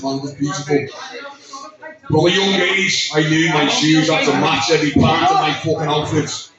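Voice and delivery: ordinary delivery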